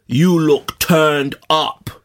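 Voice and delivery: slow, booming-prophet impression; voice of the Ten Commandments. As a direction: proclaim it slowly like scripture from above. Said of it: "like the voice of the Ten Commandments".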